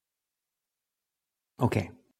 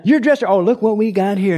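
high, loud taunt at a new recruit; mock drill-sergeant yell; 8 semitones higher, 4 dB louder than usual. Drill instructor singles out a recruit, mock yelling. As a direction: pounce on the recruit, imitating an instructor. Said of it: "they just start yelling at you".

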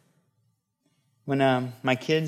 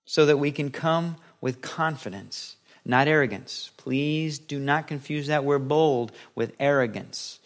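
ordinary talk

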